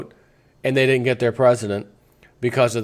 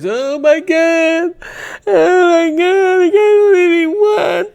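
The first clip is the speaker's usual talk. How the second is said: much higher and louder, slowed, wailing disbelief; mock hysterical liberal; 7 dB louder, slower than usual. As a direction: ham up a panicked voter who can't believe it. Mocking distraught liberals after election.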